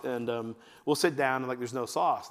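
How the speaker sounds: usual speaking voice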